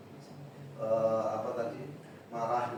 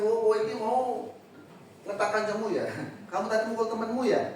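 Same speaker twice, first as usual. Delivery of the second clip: accusing teacher; 8 semitones higher than usual. Shown as a demonstration. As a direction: jump on the kid with a pointed accusation. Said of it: "tapi jangan dijustifikasi".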